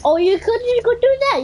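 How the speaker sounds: high excited exclamation; sudden excited realisation. Real, not acted. Excited at teammate move.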